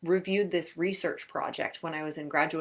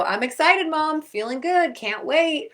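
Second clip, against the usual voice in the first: higher, louder, chirpy answer; cheery excited reply; 9 semitones higher, 6 dB louder than usual. Retold or imitated. An athlete answering mom, brightly faked excitement. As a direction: sell it, even if you're faking it.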